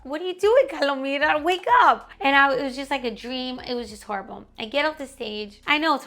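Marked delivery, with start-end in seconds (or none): 0.0-2.1 imagined friend's alarm. Retold or imitated. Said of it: "I could tell from her face".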